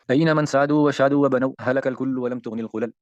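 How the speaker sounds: slow measured poetic chant; verse recitation. Acted. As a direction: intone the poem slowly, lifting the first words. Reciting the Arabic verse aloud.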